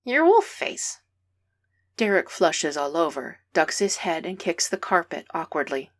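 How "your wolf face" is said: put-on high, louder, teasing kid growling like a wolf; bare teeth and grr it out in fun; playful mock growl. A narrator's reading. Boy pretending to be a wolf at Derek, playful mock growl. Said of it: "grrring at him".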